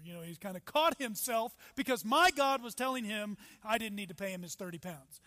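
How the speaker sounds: normal talking voice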